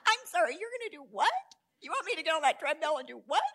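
fast, high incredulous questioning; mock disbelief. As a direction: act out a comically appalled patient. Acts out patient's disbelief at treadmill.

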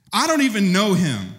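high, insistent I do not know him; panicked denial. Acted. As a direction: push the denial out fast and high. Peter's third denial.

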